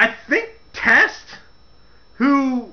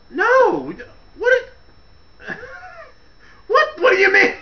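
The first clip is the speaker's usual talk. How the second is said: high incredulous cry; mock outrage; 12 semitones higher than usual. Host mocking the storyline, high and incredulous. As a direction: squeak out disbelief at the breakup.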